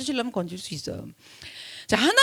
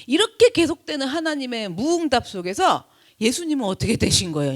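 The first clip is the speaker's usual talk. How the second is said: ordinary talk, nothing marked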